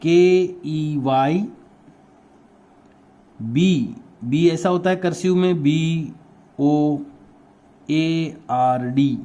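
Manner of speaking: slow letter-by-letter spelling; teacher dictating keyboard letters while writing them. Shown as a demonstration. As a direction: spell it out slowly as the class writes. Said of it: "आइए लिखते हैं keyboard".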